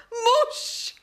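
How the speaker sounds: high mocking laugh at the word; scornful laugh. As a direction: laugh him off in disbelief. Scornful laugh at his claim. (posměšný smích)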